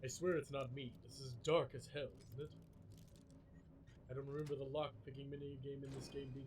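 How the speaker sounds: held low pushed voice; deep manly voice. Pretend. Streamer holding the deep voice redemption while lockpicking, deep. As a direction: keep it as deep as you can. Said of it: "I haven't had to change my voice in so long".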